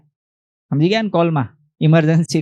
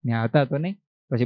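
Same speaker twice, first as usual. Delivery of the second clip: ordinary delivery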